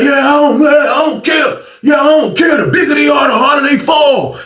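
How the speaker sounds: high shouted taunt; mock taunt. Short guy shouting a challenge at the teller, high mock yell. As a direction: bark the proverb as a threat.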